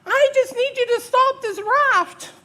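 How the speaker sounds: high, slow, desperate yell; shouting. Acted. Woman pleading with vanishing helper, slow high shout. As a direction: shout each word after someone drifting away. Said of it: "have a conversation shouting".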